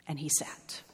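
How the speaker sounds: whispered, trailing into stillness; hushed. A narrator's reading. Narrator tells of Horton's long wait, whispering. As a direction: let it sink to a whisper. Repeated line fades to whisper.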